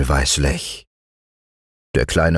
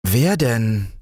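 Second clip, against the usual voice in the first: put-on higher-pitched curious child; 6 semitones higher than usual. A narrator's reading. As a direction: ask eagerly, not sorry at all. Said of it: "fragte Fritz mehr neugierig als reuevoll".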